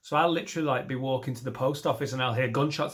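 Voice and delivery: normal talking voice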